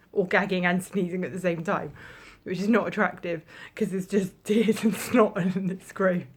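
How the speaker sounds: laughing through the words; giggly and loud. Real, not acted. Laughing about sneezing and gagging.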